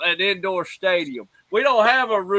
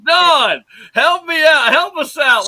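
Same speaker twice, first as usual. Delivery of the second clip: loud, higher call for backup; 8 semitones higher, 8 dB louder than usual. Real, not acted. Host loudly calling his cohost to help him out. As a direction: rope Don into the argument.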